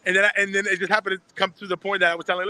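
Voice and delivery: ordinary talk, nothing marked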